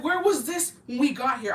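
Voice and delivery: indignant retort. Retold or imitated. Retold retort to tip-seeking driver.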